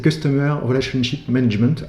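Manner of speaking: "Customer Relationship Management" in a show-off English accent; mock English accent, spelling out the acronym. Shown as a demonstration. Vlogger spelling out CRM for viewers, playful English accent. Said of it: "avec mon super accent, accent anglais".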